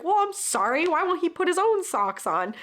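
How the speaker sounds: high-pitched mock defensive comeback; retold huffy protest; defensive retort. Acted defensive reply to scolding.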